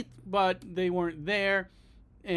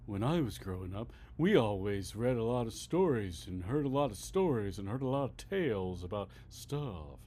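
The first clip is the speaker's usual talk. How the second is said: much lower, much softer reminiscing townsperson voice; old local reminiscing; 8 semitones lower, 6 dB quieter than usual. Retold or imitated. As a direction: play a nostalgic old-timer in a documentary. Imagined podcast interviewee reminiscing.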